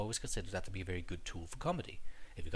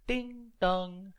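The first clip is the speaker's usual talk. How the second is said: sung two-note ding dong chime; bright mock door chime; chime imitation; 10 semitones higher, 4 dB louder than usual. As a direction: mimic the train's intercom chime.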